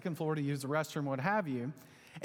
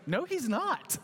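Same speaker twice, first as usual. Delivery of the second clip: put-on much higher, insistent child voice; eager little boy; 9 semitones higher, slower than usual. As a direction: jump in like a four-year-old backing his toy. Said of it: "And Eli goes".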